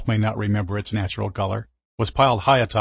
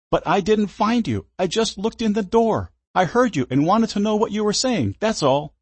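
frightened pleading; 11 semitones higher than usual. Acted. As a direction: plead in panic. Frightened teen pleads with driver.

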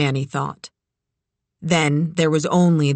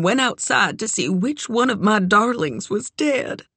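higher-pitched character voice, shaken storyteller; Doc's distressed recollection, pitched up; grieving friend Doc; 4 semitones higher than usual. Acted. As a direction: recount the dreadful night in a raised voice. Doc goes on with account.

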